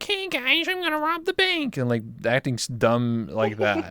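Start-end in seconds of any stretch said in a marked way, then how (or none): 0.0-1.8 put-on high squeaky kid voice, much higher; goofy kid roleplayer. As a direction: play a silly thirteen-year-old roleplayer. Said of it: "doing accents and being stupid".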